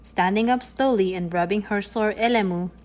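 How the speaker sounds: ordinary delivery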